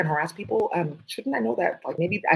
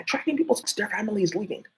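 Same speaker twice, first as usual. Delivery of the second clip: usual speaking voice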